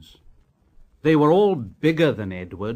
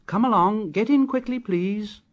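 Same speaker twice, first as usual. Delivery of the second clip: higher, eager call; Edward calling out; 8 semitones higher than usual. A narrator's reading. Edward urging passengers to board, higher eager call. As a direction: hurry them aboard cheerfully.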